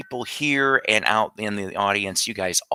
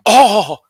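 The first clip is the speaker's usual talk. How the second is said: loud, high cartoon "oh!"; one startled anime-dub exclamation; punchy exclaimed gasp; Speed Racer; 12 semitones higher, 11 dB louder than usual. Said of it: "here my impression is just this".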